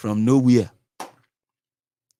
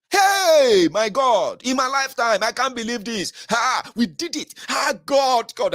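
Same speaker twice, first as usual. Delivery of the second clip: high, loud overjoyed exclamations; mock tearful joy; 10 semitones higher, 4 dB louder, slower than usual. A supporter imitating a fan's victory outburst, high and overjoyed. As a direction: gush as if too happy to believe it. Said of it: "and start crying".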